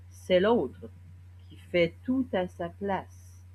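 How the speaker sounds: slow, deliberate verse with long pauses; poem recitation. Acted. Poet reciting to the accomplices of violence, measured poem recitation. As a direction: recite it phrase by phrase, weighting key words.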